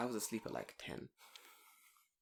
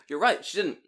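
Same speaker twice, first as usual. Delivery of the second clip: pumped-up inner pep talk; 10 semitones higher, 5 dB louder than usual. Retold or imitated. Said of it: "And I'm like".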